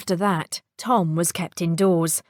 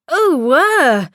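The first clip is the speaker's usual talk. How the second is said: startled; 7 semitones higher, 6 dB louder than usual. Acted. Pudding suddenly picked up.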